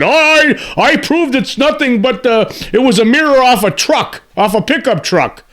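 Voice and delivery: high-pitched mocking imitation of a debunker. Host ridicules debunkers who claim it was a truck mirror, mocking. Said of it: "debunkers who have come forward and said".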